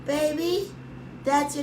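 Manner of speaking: greets him sweetly as if she really picked up; sweet phone greeting. Retold or imitated. Mimicking her recorded greeting to the husband, lightly sweet. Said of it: "I left the answering machine, say".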